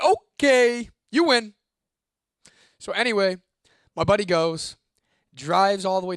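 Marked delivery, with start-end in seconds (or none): 0.0-1.5 loud, high-pitched giving in; exaggerated retold surrender, pitched up; mock surrender. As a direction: throw your hands up and back off loudly. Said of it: "I threw my hands up".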